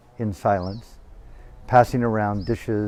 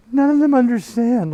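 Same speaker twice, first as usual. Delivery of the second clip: very high, loud anguished wail; wailing scream; 14 semitones higher, 6 dB louder than usual. Retold or imitated. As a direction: scream it out in grief. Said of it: "like that".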